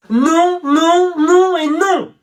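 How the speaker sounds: high, loud repeated refusal; outraged protest. Acted. Classmate loudly refusing the student's attitude, shouting. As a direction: cut him off with a loud, high no.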